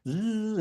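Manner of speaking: high, sustained motor hum; sewing machine buzz. Acted. Storyteller imitating mom's sewing machine, high buzz. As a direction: buzz like a little engine. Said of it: "you could just hear that little engine".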